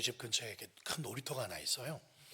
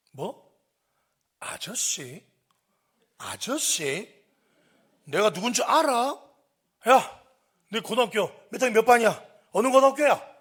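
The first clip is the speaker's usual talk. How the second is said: incredulous, building to a loud, high shout; outraged authority bearing down on a kid; forceful comeback; 9 semitones higher, 15 dB louder, slower than usual. Retold or imitated. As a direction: start affronted, then explode into interrogation. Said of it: "제가 강하게 나가니까".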